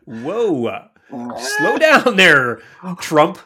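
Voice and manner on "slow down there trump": slow, loud, high call-out; raised-voice playful reprimand; mock warning. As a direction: teasingly holler it, stretched out.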